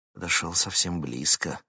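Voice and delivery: ordinary delivery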